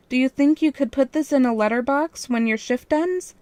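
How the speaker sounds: syrupy sweet request. A narrator's reading. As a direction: ask sweetly to win her over. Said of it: "I asked in a sweet voice".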